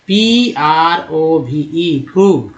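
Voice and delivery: P R ओ V E spelled out very slowly, then प्रूव said firm; slow spelled word. Shown as a demonstration. Teacher spelling out the word for students, slow and clear. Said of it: "प्रूव में ओ का उच्चारण".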